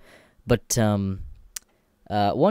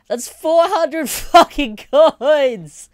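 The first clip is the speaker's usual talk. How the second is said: laughing shout; 9 dB louder, much slower than usual. Real, not acted. Overwhelmed by the gift of coins.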